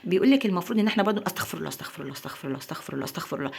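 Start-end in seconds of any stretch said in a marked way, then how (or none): 1.3-3.5 a fast, much softer mumble, the words half swallowed; mechanical rote istighfar. Shown as a demonstration. Host showing viewers the wrong, thoughtless way to repent: soft rote mumble. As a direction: rattle it off under your breath without meaning it. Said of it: "احنا مش بنقولها كده".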